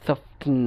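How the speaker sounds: সফটেন with t sounded before n; T pronounced. Shown as a demonstration. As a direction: keep the t in সফটেন, f-t-n. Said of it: "অর্থাৎ কষ্ট হচ্ছে".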